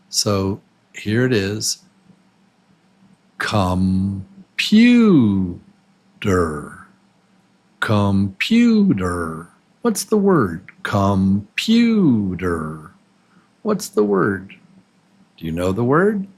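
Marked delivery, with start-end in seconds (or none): 3.4-9.5 sound by sound. Shown as a demonstration. Teacher has students guess the word, "Computer" sound by sound. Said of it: "these are the sounds of the word". 10.8-12.9 "Computer" in slow separate pieces, com-pyu-der; sound by sound. Shown as a demonstration. Teacher has students guess the word, "Computer" sound by sound. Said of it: "these are the sounds of the word".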